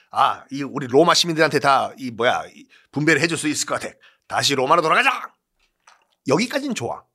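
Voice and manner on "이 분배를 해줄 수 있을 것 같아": ordinary talk, nothing marked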